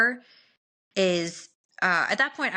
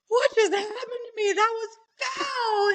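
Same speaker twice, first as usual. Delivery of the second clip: high, whiny, half-crying complaint; hammed-up crying whine; whiny disgust; slower than usual. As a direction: wail it in mock despair. Shocked after water breaks.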